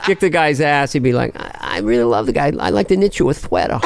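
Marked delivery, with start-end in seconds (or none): 1.4-3.8 put-on sweet post-fight boxer, Brooklyn accent; gentle boxer, talk-show host gone Brooklyn; Mike Tyson. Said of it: "it's just Jay Leno with a Brooklyn accent".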